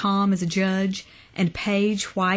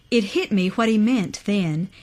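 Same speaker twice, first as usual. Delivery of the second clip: ordinary delivery, nothing marked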